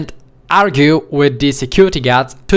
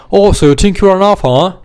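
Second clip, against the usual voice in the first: fast, louder in-your-face taunt; drunk guard taunting; 3 semitones higher, 3 dB louder than usual. Retold or imitated. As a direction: sneer it right up in his face. Guard gets in his face.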